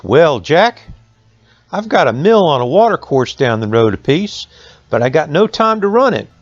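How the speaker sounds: lightly voiced mill owner.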